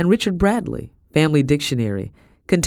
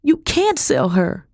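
high, slow desperate protest; high-pitched outcry; bursting out; 11 semitones higher, slower than usual. A narrator's reading. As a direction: burst out in anguish. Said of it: "I burst out".

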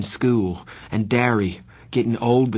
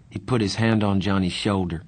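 ordinary delivery

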